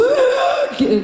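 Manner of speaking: big noisy gasp of air; high gasp with a laugh; loud gasping inhale. Shown as a demonstration. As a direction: show the wrong gasp for breath. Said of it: "sip the air".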